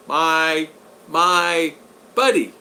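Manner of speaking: drawn-out, very slow greeting; talking too slow. Real, not acted. Streamer greeting a regular in the chat, talking too slow. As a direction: stretch each word out slowly. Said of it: "I'm talking too slow again".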